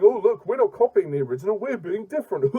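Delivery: high, sing-song mocking voice; hammed-up parody of self-congratulation; mock boasting. Reviewer mimicking filmmakers pleased with their changes, high mocking voice. Mocks filmmakers showing off novelty.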